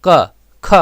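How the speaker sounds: क, ख with a short vowel, each alone; teacher sounding out short क, ख; short vowel demo. Shown as a demonstration. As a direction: say क and ख as brief separate syllables. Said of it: "ऐसी ध्वनि जो छोटी हो".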